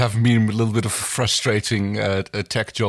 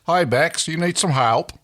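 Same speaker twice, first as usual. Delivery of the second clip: put-on high, loud Australian accent; chirpy Australian accent; 8 semitones higher, 4 dB louder than usual. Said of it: "do our Australian accents again".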